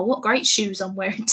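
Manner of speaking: ordinary delivery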